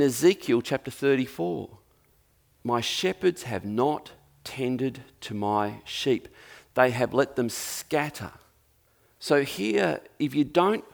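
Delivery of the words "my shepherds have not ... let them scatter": solemn scripture reading. Acted.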